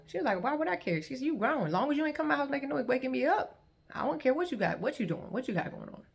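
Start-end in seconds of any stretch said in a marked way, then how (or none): 0.4-1.0 high-pitched incredulous brush-off; dismissive. Retold or imitated. Aunt answering her niece's question, dismissive. 1.2-6.0 high, quick-tongued aunt imitation; dismissive. Aunt telling grown niece she doesn't care, dismissive. Aunt's dismissive answer, acted out.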